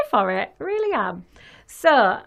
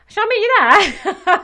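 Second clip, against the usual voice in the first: lightly laughing, unbothered offer; breezy resigned; 9 semitones higher, 6 dB louder than usual. Retold or imitated. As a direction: toss it off breezily. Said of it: "I'm just like".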